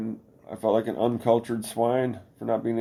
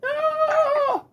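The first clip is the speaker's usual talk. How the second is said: long, very high falsetto wail; mock metal scream; 3 dB louder than usual. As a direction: shriek like a hair-metal singer. Mocks hair-metal screaming.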